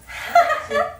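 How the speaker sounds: high-pitched squealing laugh; high burst of laughter. Real, not acted. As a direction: let out a shrill laugh. Laughs at the clip.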